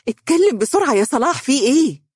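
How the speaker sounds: high, urgent demand; anxious cry. A narrator's reading. Boss demands the bad news from Salah, anxiously. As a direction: press him in alarm to speak. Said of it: "هتفت بقلق".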